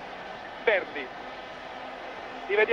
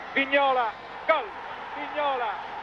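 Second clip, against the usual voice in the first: higher, urgent goal shout; breathless italian goal commentary; 6 semitones higher than usual. Real, not acted. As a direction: call the scorer in a rush. Live goal call on radio. (grido del gol concitato)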